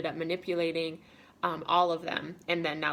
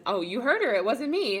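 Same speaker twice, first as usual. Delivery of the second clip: high, louder mock-innocent protest; suspicious denial; 6 semitones higher, 6 dB louder than usual. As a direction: imitate a guilty person pleading innocence. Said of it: "probably the most suspicious thing ever".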